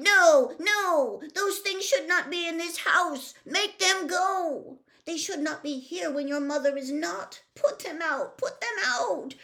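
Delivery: high, loud, fussy fish; upset fish voice. Acted. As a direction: play a panicky little fish, loud and high. Fish panicking.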